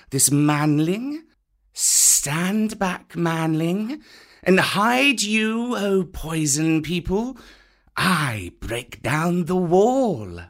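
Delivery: high, slow snake voice; slow and commanding; python Kaa. A narrator's reading. Kaa warning the cobras before smashing the wall.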